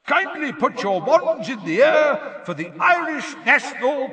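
booming, high-pitched announcer's roar. A narrator's reading. Ludo Bagman announcing to the stadium, roaring. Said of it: "roared Ludo Bagman's voice".

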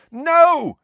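high, loud yell of alarm; urgent full-voiced shout. A narrator's reading. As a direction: yell to stop them. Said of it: "Harry yelled".

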